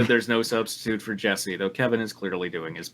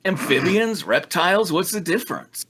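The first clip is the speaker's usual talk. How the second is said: higher-pitched dismissive sneer; 7 semitones higher than usual. Acted. Damien dismissing Brock's correction, sneering. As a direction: brush the distinction aside. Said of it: "Damien sneered".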